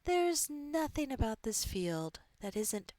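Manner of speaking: soft, hushed meditation voice with long pauses; guided meditation. Acted. Coach guiding listeners into an open field, soft meditation voice. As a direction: ease the listener gently into a visualization.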